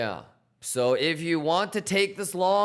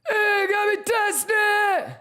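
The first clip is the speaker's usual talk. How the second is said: very high drawn-out yell; shout; 4 dB louder than usual. Acted. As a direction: explode into a slow high yell. Explosive reveal shout.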